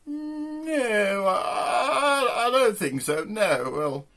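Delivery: high, wavering mock lady's voice; posh woman. Posh woman declining a car ride, high put-on voice. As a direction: dither and refuse in a high posh voice.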